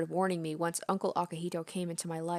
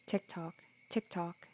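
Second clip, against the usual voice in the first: much softer, slow drawn-out whisper; slow whispered tick-tock; 9 dB quieter, slower than usual. Acted. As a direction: let the ticks stretch out slowly. Clock counting, slowing down.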